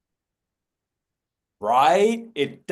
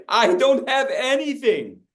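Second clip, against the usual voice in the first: mock helpless; 7 semitones higher than usual. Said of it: "universal sign for".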